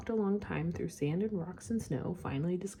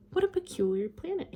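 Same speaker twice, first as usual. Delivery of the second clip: puzzled prince; 10 semitones higher, 5 dB louder than usual. A narrator's reading. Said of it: "he thought".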